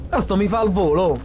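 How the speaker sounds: usual speaking voice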